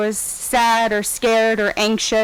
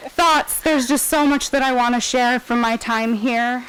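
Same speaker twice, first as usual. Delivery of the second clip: ordinary talk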